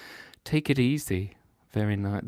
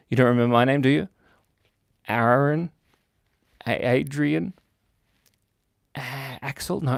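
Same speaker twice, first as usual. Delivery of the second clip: ordinary delivery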